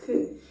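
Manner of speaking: k as one bare sound; k with no vowel at all; single sound. Shown as a demonstration. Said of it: "you hear one sound".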